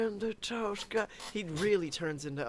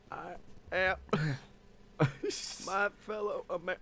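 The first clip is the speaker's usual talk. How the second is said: attempted Obama. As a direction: try a hushed Obama and lose it. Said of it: "I just tried to do Obama".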